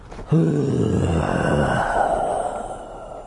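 long drawn-out exasperated sigh. Shown as a demonstration. Narrator fed up with the useless guard, long sigh. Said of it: "That was my exasperated sigh".

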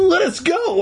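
high-pitched mock shriek; high, urgent plea to be freed. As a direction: cry out high, without much volume. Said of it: "I shrieked".

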